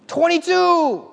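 very high, loud shriek; imitated boy's squealed outburst. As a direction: shriek the number at the top of a kid's range. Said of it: "it's getting higher and higher and higher".

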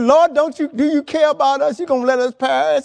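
loud, high cry for rescue; acted alarm, disciples wailing at Jesus; panicked pleading. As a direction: plead loudly as if about to drown. Frightened disciples cry out in storm.

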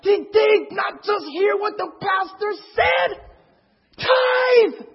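high, loud, drawn-out squealing disbelief; incredulous outburst. Retold or imitated. Newcomers to an unmoved congregation, shrieking in disbelief. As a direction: shriek it slowly, amazed nobody else reacts.

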